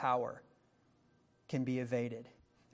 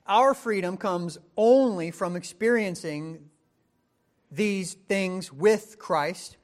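loud, higher, hammering rhetorical questions; preaching at full force; 6 semitones higher, 9 dB louder than usual. Acted. Preacher pressing the congregation on why Christ was raised, full force. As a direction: build it up like a revival preacher.

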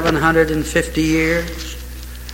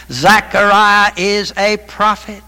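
ordinary talk, nothing marked

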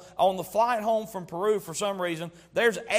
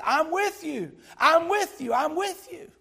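reassuring God; 8 semitones higher than usual. Acted. Preacher voicing God's reassurance.

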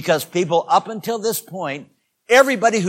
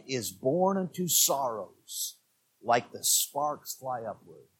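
low, soft and slow sermon voice; quiet earnest pulpit tone; hushed solemn preaching; 5 semitones lower, 7 dB quieter, slower than usual. Acted. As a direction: lean in and speak gravely, softly.

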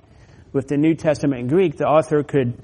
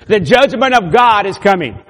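raised, high-pitched preacher's cry; loud proclamation; 6 semitones higher, 9 dB louder than usual. Acted. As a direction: ring it out as a warning to the church. Proclaiming the warning to that church.

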